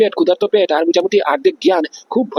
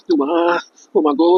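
sickly groan; 6 semitones higher than usual. Acted. Officer sick with fever moaning to himself, sickly groan.

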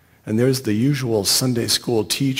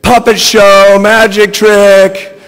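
loud, high, stretched-out squeal; 8 semitones higher, 12 dB louder, slower than usual. A narrator's reading. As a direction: squeal it at full volume, dragging the ends. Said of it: "the whole time squealing".

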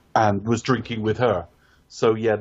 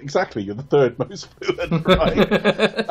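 laughing through a quote; 7 semitones higher, 3 dB louder, slower than usual. Retold or imitated. Retelling a funny film line.

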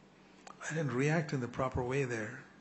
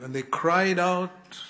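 raised, slow and stretched on cried; emphatic cry out; 6 semitones higher, 5 dB louder, slower than usual. Acted. Preacher dwelling on how they cried out, stressing cried. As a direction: lean hard into cried.

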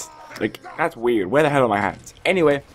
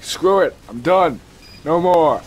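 higher, punchy mock giving up; mock fed-up outburst; 5 semitones higher, 4 dB louder than usual. Player voicing someone throwing a gun away, mock fed-up outburst. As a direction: throw in the towel in three short bursts. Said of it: "It's like".

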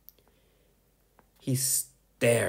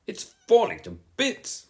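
moaning; 13 semitones higher, 4 dB louder than usual. Acted. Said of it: "moaned Eugene".

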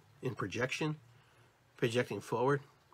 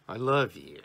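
LOVE leaned on, firm and low; stressed inflection; 4 semitones lower than usual. Shown as a demonstration. Speaker demonstrates inflections, stress on love. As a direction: punch the word love, falling away on you. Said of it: "we use inflections".

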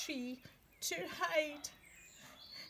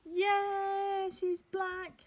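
excited; 7 semitones higher, much slower than usual. Real, not acted. Delighted reading to children.

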